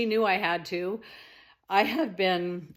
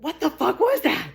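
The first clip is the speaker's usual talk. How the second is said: startled; 6 semitones higher, 4 dB louder than usual. Retold or imitated. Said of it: "And it was just".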